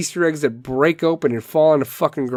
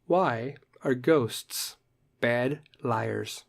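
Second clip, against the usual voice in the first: slow spooky setup; 3 semitones lower, 9 dB quieter, much slower than usual. Shown as a demonstration. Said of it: "Let's do the dirty dad joke".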